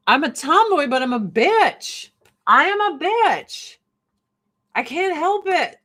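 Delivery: high, swooping mock whine; hammed-up protest. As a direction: plead helplessly, playing it up. Comic plea about being girly.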